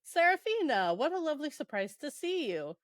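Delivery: theatrical pleased greeting; mock delight. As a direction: swoop up on the name in feigned pleasant surprise. Acted greeting of the book's character.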